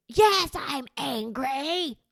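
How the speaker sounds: drawn-out mock tantrum voice; angry child. Child answering a parent's guess, angry outburst. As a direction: stretch it out like a cross kid.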